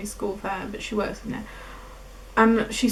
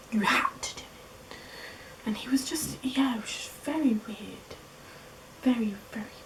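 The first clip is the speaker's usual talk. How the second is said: 1 hushed unease; 10 dB quieter than usual. Real, not acted. Recalling intimidation.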